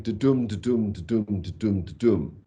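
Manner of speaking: even, repetitive "da-dum"; boring bird song. Shown as a demonstration. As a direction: tick through each "da-dum" without variation. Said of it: "which is a boring bird song".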